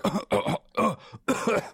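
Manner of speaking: high, exaggerated little coughs; mimicked choking cough. Sibling mocks brother's near-choke, imitated coughing. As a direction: imitate a feeble choking fit. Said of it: "You went like this".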